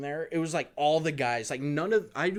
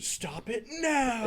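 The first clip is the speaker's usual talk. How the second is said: mock teacher scold; 9 semitones higher, slower than usual.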